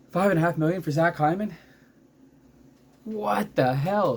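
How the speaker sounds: incredulous outburst. Real, not acted. Outraged at Hyman's cheap contract.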